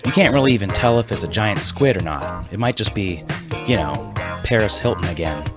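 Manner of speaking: usual speaking voice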